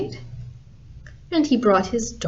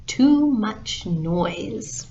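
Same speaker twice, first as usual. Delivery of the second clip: slow, deliberate title announcement; measured storybook sign-off; closing title; much slower than usual. Real, not acted. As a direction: spell out the story's name slowly. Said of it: "And that's the story".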